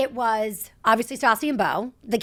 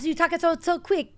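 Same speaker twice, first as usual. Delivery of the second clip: put-on high Italian accent, lightly done; quick Italian lilt; 6 semitones higher than usual. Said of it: "Can you do it?".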